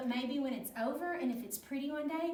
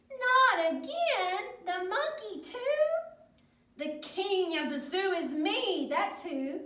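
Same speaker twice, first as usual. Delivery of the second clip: exasperated and hammed-up for children; indignant disbelief; 7 semitones higher, 6 dB louder than usual. A narrator's reading. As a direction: play an outraged storybook king. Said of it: "Carlos scratched his head in disbelief".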